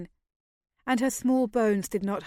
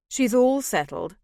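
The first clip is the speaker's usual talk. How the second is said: light; 3 semitones higher, 4 dB louder than usual. A narrator's reading. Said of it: "he added lightly".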